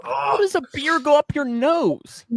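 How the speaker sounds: high, slow disbelief; incredulous. Real, not acted. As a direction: ask in disbelief. Friend choked on beer.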